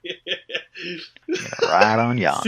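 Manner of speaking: laughing Western drawl; cowboy accent. Pretend. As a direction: play a cowboy on a ranch. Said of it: "sounds more like a cowboy".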